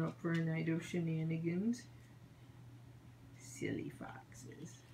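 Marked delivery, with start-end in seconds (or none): 3.4-4.8 soft slow whisper. Real, not acted. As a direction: whisper it slowly to the pets. Cooing at pets.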